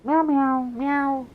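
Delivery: high mock meowing; cat meows. Streamer mimics his cat in the cage, high mock meows. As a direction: meow like an unbothered cat. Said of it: "You were just like".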